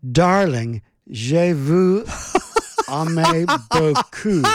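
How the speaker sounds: a hint of a sultry French accent, slow at the end; mock French. Host hamming up sultry French for a listener. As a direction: purr it like a cabaret vamp, drawn out. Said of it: "have her say this for you".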